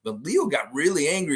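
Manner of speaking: ordinary talk, nothing marked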